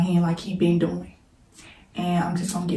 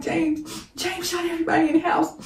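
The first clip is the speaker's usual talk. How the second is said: retelling the crying sister, much higher and frantic; high, panicked retold cry; 10 semitones higher than usual. Sister on the phone breaking the news, frantic and high. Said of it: "she crying".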